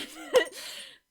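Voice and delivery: high unvoiced laughing burst; breathy laugh. Real, not acted. Streamer to chat, laughing breathily at her fear. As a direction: let a silent high laugh out.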